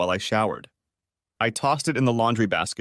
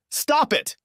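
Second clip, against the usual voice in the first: high piercing scream of stop it. A narrator's reading. Wife screams at husband to stop, a high shriek. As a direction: shriek it out, desperate. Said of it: "She screamed".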